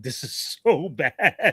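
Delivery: drawn-out words broken by laughing; dying of laughter. Retold or imitated. Friend recalling the terrible fake accent, laughing. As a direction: laugh through it, slowly.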